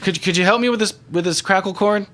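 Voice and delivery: high character voice asking for help; high-pitched, needy request; pleading odd stranger. Acted. Game master voices Edgar.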